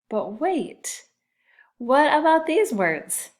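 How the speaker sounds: high, loud but wait; mock surprise to second graders. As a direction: act puzzled for the class. Teacher feigning surprise to class.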